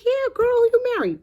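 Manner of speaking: very high-pitched excited questioning; astonished friend. Acted. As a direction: squeal the question at your girlfriend. Startled friend reacts to marriage news.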